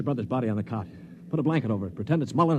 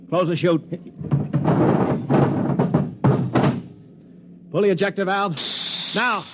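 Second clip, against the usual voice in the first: urgent orders; 6 semitones higher, 4 dB louder than usual. Acted. Ordering the ejection into space.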